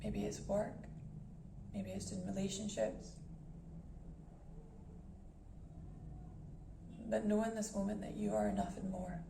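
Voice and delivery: calm guided meditation voice with long pauses. Acted. Meditation host guiding listeners to breath and body, calm and measured. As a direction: ease listeners into stillness, unhurried and even.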